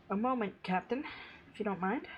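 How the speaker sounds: a hint of a polite officer; polite NPC voice. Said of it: "Rhea says".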